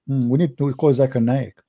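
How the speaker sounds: usual speaking voice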